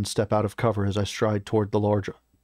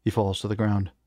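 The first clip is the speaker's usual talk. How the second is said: ordinary delivery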